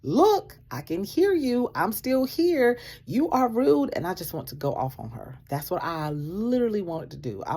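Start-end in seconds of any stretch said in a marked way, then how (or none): 0.0-3.9 loud, high telling-off; indignant raised voice; angry call-out. Retold or imitated. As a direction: snap back at the rude worker. Confronting the rude manager.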